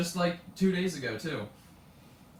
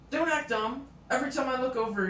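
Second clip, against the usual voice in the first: high-pitched imitation of an accusing teacher; mock scolding; 9 semitones higher, 3 dB louder than usual.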